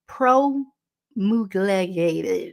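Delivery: promulgated dragged out very slowly in a Baltimore accent. Shown as a demonstration. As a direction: stretch promulgated syllable by syllable, Baltimore-style. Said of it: "blah, blah, the Baltimore accent".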